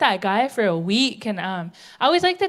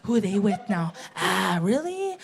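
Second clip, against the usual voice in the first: gossiping sisters; 4 semitones lower, 4 dB quieter than usual. Retold or imitated. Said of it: "We gossip about all our family".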